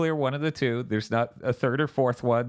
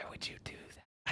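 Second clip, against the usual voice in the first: breathy mock whisper, unvoiced; 17 dB quieter than usual. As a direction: whisper it like a secret aside. Mocking whisper aside.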